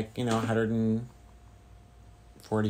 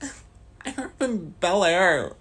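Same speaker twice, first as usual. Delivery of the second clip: laughing, slow, higher snooty voice; mock rich kid; 8 semitones higher, 4 dB louder than usual. Joking to chat as a snooty Bel Air kid. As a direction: lightly play a snobby Bel Air kid.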